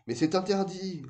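high, protesting interjection; interrupting. A narrator's reading. Prune cuts off Moka's plan for the tower, interrupting. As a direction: cut in with an alarmed objection. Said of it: "interrompit Prune".

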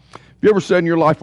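usual speaking voice

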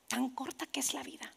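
very soft, almost whispered; quiet, solemn wonder; hushed realisation. Retold or imitated. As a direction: let it sink in under the breath. Sobering realisation after a pause.

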